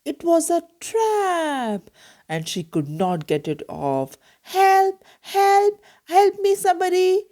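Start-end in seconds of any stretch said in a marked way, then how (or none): 4.4-7.3 high, loud cries for help; desperate shout for help. Acted. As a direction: yell for help, caught in a trap. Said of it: "shouted Amy".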